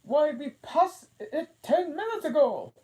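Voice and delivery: high, loud queenly voice; the Queen correcting Alice. A narrator's reading. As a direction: sound grand and dismissive.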